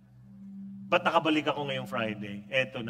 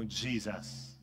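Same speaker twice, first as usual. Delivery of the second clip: soft smiling Jesus; 8 semitones lower, 8 dB quieter than usual. Pretend. Smiling, peaceful last word.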